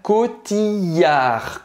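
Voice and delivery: Cotillard said very slowly with a long "i" and no L, as "kotiaʁ"; long French I. Shown as a demonstration. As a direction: stretch the I in Cotillard. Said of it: "como se fosse um I longo".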